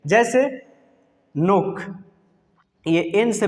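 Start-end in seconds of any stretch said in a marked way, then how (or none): 1.4-1.8 नोक starting straight on the n; silent k. Shown as a demonstration. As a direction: say नोक with no k before the n. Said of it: "के को pronounce नहीं करेंगे". (नोक में के साइलेंट)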